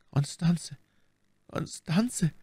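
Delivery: soft, high, stricken murmur; stammering. A narrator's reading. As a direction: falter on the beloved's name. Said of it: "stammelte D'Artagnan". (leise und hoch gestammelt)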